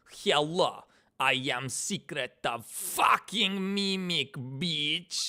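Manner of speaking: drawn-out, hammy villain-style delivery; character voice demo. Shown as a demonstration. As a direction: stretch the words out in a put-on character voice. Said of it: "original clips of me going".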